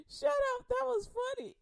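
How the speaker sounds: high laughing shut up; laughing mom. Retold or imitated. Laughing mom teasing kids after a joke. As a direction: squeal it through laughter. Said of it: "She was like".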